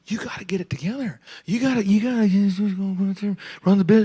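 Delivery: whispered, teary pep talk; mentor whispering. Retold or imitated. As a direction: whisper urgent encouragement. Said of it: "He's like".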